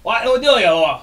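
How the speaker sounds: clumsy, hammed-up British lout; put-on British accent. Player imitating the barbarians, rough British accent. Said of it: "you're messing up my accent".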